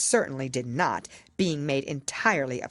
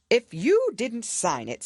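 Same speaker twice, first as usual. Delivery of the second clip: higher, louder royal pronouncement; pompous king; 7 semitones higher, 5 dB louder, slower than usual. A narrator's reading. King explaining the handwriting to the jury, pompous. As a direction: declare it like a self-important king.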